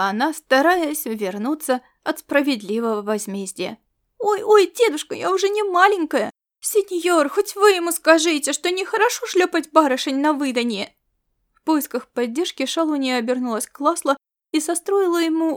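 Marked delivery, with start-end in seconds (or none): 4.2-10.9 высокий голос девочки-проказницы; жалуйся высоко, ища защиты у сеньора; жалобные протесты. A narrator's reading. Девочка уворачивается от наказания деда. (жалобно протестуя, высоким голосом)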